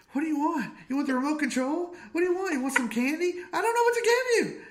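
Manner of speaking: high, loud, frantic pleading; frantic parent. Retold or imitated. Parent begging a screaming toddler to say what he wants. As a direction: rattle off options to a screaming toddler.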